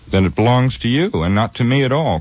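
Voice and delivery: high, amazed outburst of surprise; amazed cry. A narrator's reading. Frodo cries out amazed at the sword's owner. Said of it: "cried Frodo in amazement".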